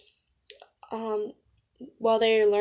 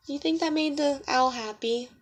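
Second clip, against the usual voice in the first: plain reading voice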